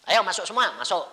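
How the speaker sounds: loud, high call into the room; preacher calling the family into the room. Retold or imitated. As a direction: summon them all in.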